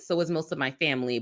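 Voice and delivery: ordinary talk, nothing marked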